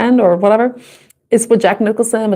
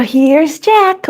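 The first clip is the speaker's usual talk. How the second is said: quoting Jack Nicholson; 6 semitones higher than usual. Retold or imitated. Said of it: "you know".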